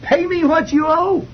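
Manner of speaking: hammed-up creditor gripping the throat; mock furious demand. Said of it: "and he says".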